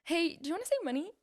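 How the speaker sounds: put-on high, pleading sales girl voice; mock sweet sales pitch. Girl at a door pleading with a homeowner, high and sweet. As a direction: beg them sweetly to save money.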